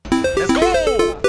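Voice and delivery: ordinary talk, nothing marked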